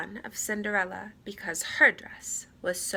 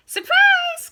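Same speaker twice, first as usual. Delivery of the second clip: very high, loud squeal; joyful surprise; 13 dB louder than usual. Acted. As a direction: burst out with glee. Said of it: "cried the mice".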